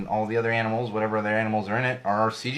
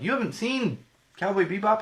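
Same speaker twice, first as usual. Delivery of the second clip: shocked disbelief; 9 semitones higher than usual. Retold or imitated. Classmates' shocked question.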